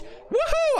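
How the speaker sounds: very high-pitched cheer; excited whoop. Pretend. Streamer celebrating to chat about speaking French, high excited whoop. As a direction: let out a squealed whoop.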